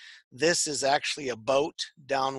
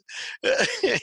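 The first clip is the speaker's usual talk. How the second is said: high laugh. Real, not acted. Joking about the accent.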